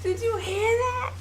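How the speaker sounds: high, slow, whiny incredulous voice; Mars Blackman voice. Retold or imitated. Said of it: "Mars was like".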